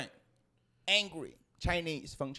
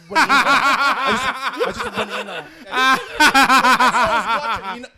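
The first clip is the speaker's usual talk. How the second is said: higher and louder through real laughter; laughing; 6 semitones higher, 15 dB louder than usual. Real, not acted. Telling a friend about the fight, laughing loudly. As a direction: crack up at your own story.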